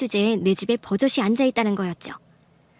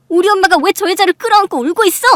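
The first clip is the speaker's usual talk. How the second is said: high, loud, outraged questioning; angry demand; 8 semitones higher, 8 dB louder than usual. Acted. Daughter seeing her mother hug the other woman, outraged. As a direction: demand an explanation at full voice. Wife demands answers from husband.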